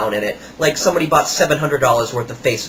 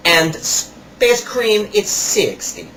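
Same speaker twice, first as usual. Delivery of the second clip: higher, slow put-on salesman voice; imitating the salesman; 5 semitones higher, slower than usual. Kiosk salesman rubbing salt on a customer's hands, imitated higher and slower. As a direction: ham up the pushy kiosk seller. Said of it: "And he goes".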